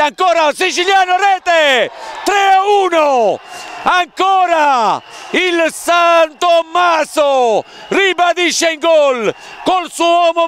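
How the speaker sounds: excited goal call. Acted. (urlo del gol decisivo)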